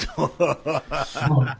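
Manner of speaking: loud, high burst of laughter; real laughter. Real, not acted. Panelist caught laughing during a colleague's serious point, real laughter. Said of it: "[name] se está riendo".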